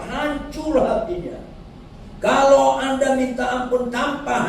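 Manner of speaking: fervent pulpit preaching. Acted. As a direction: preach the parable with fervour. Preaching at full force.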